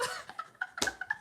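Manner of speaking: very high squeaky giggle; squeaky laugh. Real, not acted. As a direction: squeal like a squeaky bicycle wheel. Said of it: "my laugh sound like a squeaky bicycle wheel".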